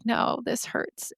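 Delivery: slow, soft, breathless whisper through tears; tearful whisper. Retold or imitated. Telling her boyfriend on the tour it hurts, in a tearful whisper. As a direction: barely get the words out while crying. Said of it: "I was crying because it hurt".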